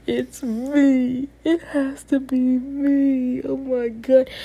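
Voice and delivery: high, drawn-out mock wail; panicked self-blame. Telling how she blamed herself for coworker's anger, high panicked wail. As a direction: wail it in exaggerated dismay, high and stretched. Retold panic that he's mad.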